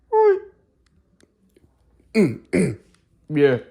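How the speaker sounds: lightly voiced little brother, grunting replies; impression of my brother. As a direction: play the brother with a few grunts and a yeah. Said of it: "impression of my brother".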